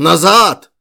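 very loud hoarse yell; hoarse shout. A narrator's reading. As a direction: bellow the order over the crowd. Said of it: "закричал он сиплым голосом". (сиплый громкий крик)

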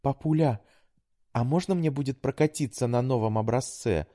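high, purring kittenish coax. A narrator's reading. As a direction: purr it like a sweet kitten. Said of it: "промурлыкала Александра милым котёнком".

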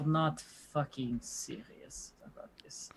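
hushed disbelieving whisper. Real, not acted. Disbelieving aside at the table.

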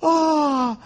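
high delighted gasps of wonder; awed tourists. Retold or imitated. Mimicking tourists in Patagonia gasping at the view, high and delighted. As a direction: gasp in awe at the scenery. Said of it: "toda la gente".

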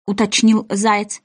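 usual speaking voice

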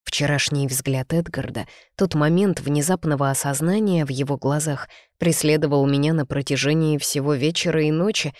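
ordinary delivery

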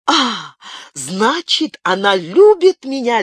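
put-on young man learning she loves him, jubilant cry. A narrator's reading. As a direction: cry it out in triumph. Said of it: "ликующе выкрикнул он".

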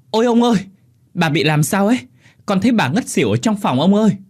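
high, loud servant's alarm; panicked shouting. A narrator's reading. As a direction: cry out frantically for the master. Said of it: "Nó la ẩm lên rồi hô hoán". (hô hoán hốt hoảng, giọng cao)

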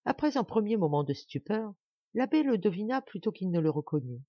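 usual speaking voice

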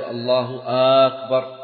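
"أكبر" begun with a held "aa", as "aakbar"; lengthened second hamza. Shown as a demonstration. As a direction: stretch the first vowel of "أكبر" into a long "aa". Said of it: "لا يمد الهمزة في الجزء الثاني".

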